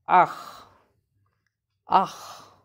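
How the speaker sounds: ah with a rasping ch from the back of the throat; demonstrated ah. Shown as a demonstration. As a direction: breathe out ah, ending in a throaty ch. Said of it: "Again, listen properly".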